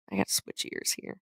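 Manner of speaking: quick whisper to herself; whispered aside. Real, not acted. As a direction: toss it off under your breath, fast. Aside while fixing headphones.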